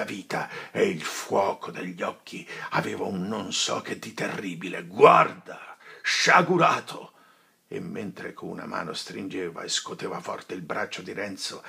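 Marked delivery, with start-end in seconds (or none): 4.8-7.1 louder, higher cry; furious call; commanding shout. Acted. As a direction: make him look. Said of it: "gridò il padre Cristoforo".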